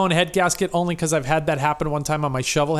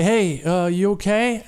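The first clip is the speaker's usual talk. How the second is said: concerned passerby; 4 semitones higher than usual. Retold or imitated. Driver passing a stranded biker, high mock concern. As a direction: play a too-friendly passerby checking on him. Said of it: "every person that goes by".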